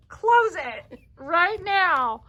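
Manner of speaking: high, drawn-out mock command; playful, exaggerated scolding; sassy retold order. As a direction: imitate a bossy coach, slow and high. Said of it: "you were the one sassing".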